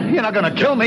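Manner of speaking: panicked plea for his life; rising panic. Acted. Pleading as gun is raised.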